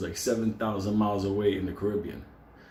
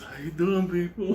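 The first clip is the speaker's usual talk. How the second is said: higher, lightly cartoonish creature greeting; Snuffleupagus; 7 semitones higher than usual. Caffeine-deprived man greets people, like a puppet creature. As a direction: play a big puppet saying hello. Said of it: "Like Snuffleupagus".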